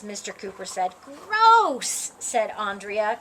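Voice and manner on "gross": grossed-out groan; a touch of disgust. Acted. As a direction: say it like something's slimy. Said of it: "covering her ears".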